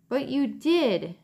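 put-on slow, high reproachful boy; accusing. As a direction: lay the blame on him slowly. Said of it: "Keith's voice was accusing".

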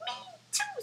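very high tiny mouse squeak; squeaky mouse. Acted. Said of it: "squeaked the mouse".

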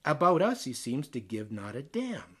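plain reading voice

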